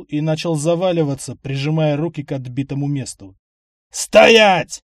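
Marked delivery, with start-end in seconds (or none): 3.9-4.8 loud, sharp bark; barking command. Acted. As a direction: snap a command to stop her. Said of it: "рявкнул я старухе".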